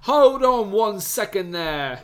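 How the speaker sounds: slow, high, theatrical English actor voice; Laurence Olivier. Retold or imitated. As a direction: draw it out like a grand old thespian. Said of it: "Laurence Olivier comes out and is like".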